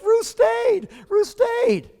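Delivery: mock weepy wife. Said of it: "Cindy be over there crying like".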